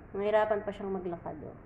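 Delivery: usual speaking voice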